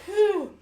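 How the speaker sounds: high, loud blowing out of breath; loud whew from spicy heat. Real, not acted. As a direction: blow out hard against the burn. Mouth burning after hot wing.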